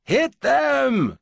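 high, loud drawn-out battle cry; bellow. A narrator's reading. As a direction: bellow the order at full force, pitch way up. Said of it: "he bellowed".

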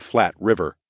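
plain reading voice

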